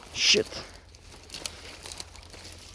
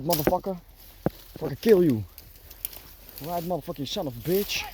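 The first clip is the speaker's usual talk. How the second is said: ordinary delivery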